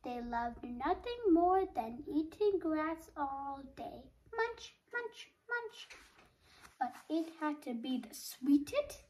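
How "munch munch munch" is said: high, light munching words; playful high-pitched chomping words; munching refrain. A narrator's reading. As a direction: pop out each munch high and soft. Imitated eating of the goats.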